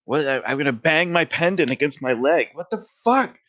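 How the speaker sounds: high-pitched, worked-up disbelief; incredulous outburst. Real, not acted. Exasperated outburst at the roll.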